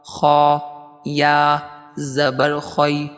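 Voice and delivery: slow, chanted spelling drill; sing-song recitation. Acted. Teacher leading students in a slow chanted drill. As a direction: chant each letter for the class to repeat.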